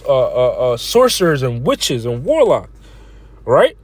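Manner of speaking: normal talking voice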